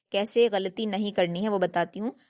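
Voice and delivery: normal talking voice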